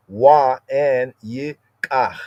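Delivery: wa said slowly with a w sound, and yikach drawn out; careful teacher's wa yikach; slow Semitic wa. Shown as a demonstration. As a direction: spell out wa and yikach, unhurried. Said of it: "Afro-Semitically speaking".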